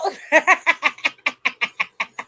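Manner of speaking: loud laugh falling away; real laughing fit. Real, not acted. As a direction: laugh hard, caught out. Laughs at being called out.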